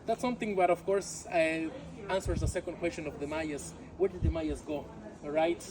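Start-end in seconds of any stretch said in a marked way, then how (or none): none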